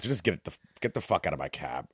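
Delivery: low, quiet dismissal; subdued, weary cab driver ordering passengers out; retold weary order. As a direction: tell them to leave, keeping it down. Cabbie first orders them out quietly.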